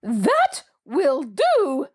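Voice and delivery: slow, choked-off words; trying to speak but the voice gives out; voice failing. A narrator's reading. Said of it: "she had lost her voice in her excitement".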